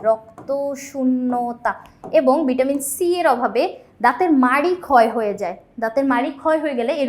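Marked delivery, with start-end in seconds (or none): 0.1-1.7 slow repeat. Shown as a demonstration. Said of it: "রক্ত শূন্যতা হয়".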